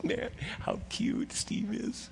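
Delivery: put-on hushed, slow whisper as God, smiling at a child; God, fondly amused. God smiling down at pleading Steve, hushed and fond. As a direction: breathe it out like a fond father watching.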